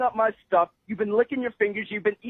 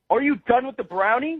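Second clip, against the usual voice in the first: ordinary delivery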